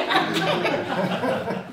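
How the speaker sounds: a big high burst of laughter; loud laugh. Real, not acted. Laughing at her own retold indignation, a loud laugh.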